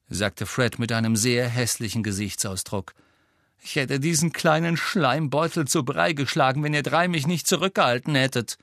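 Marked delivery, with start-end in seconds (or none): 3.6-8.6 heated, higher-pitched threat; angry rant. A narrator's reading. Fred rages about the Schleimbeutel, angry. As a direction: fume about the fight you were held back from.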